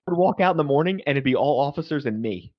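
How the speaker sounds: ordinary talk, nothing marked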